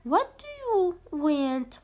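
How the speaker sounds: slow, stretched question to Dina; dad asking Dina, read slowly; slow model question. Shown as a demonstration. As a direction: draw it out for pupils to copy. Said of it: "قالها دينا".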